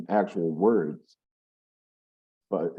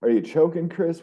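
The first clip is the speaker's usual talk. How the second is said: surprised; 8 semitones higher, 4 dB louder than usual. Real, not acted. Teasing call to friend.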